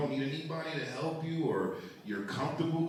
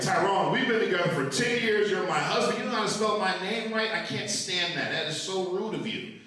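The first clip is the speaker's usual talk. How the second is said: higher-pitched mock scolding; upset wife; 5 semitones higher than usual. Wife scolding husband for misspelling her name, mock upset. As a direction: complain at him as the offended wife. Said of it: "she gets very upset at that".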